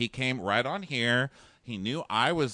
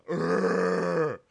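higher, imitated monster growl; mock Hulk roar; beast growl; 6 semitones higher, 4 dB louder than usual. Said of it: "he's like a beast".

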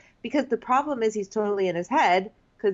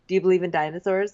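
quoting Dean; 3 semitones lower, much faster than usual. Retold or imitated. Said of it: "He's like".

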